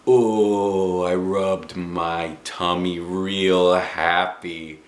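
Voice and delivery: usual speaking voice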